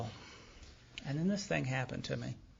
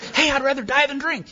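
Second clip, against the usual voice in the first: high, loud hammed-up announcement; proud shout; 12 semitones higher, 13 dB louder than usual. Newcomer running back to his buddies, shouting proudly. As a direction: holler the great news to your pals.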